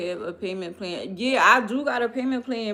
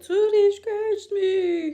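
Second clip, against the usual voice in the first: put-on much higher, louder, slow whiny kid voice; imitating a kid; 13 semitones higher, 6 dB louder, slower than usual. Retelling how Jenna complained about the cat, as a slow high whiny kid. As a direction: play the little girl telling on the cat. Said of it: "but Jenna was like".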